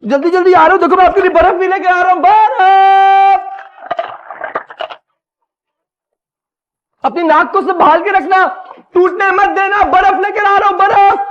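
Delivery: loud, high-pitched shout to another room; calling out. Acted. Husband shouting to hurt wife from the kitchen, calling out. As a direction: yell back frantically that help is coming.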